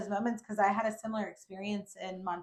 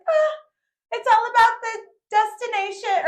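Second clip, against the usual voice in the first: high loud mock-wise saying; smug proclamation; 13 dB louder than usual. Showing off on the rocks, high smug proclamation. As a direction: pronounce it like a guru. Smug quote, then corrected.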